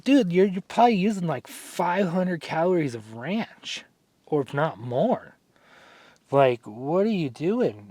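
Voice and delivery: high, disbelieving, slowing right down; incredulous dude. Retold or imitated. Telling the gym guy off about his ranch, in high mock disbelief. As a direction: act floored by the ranch.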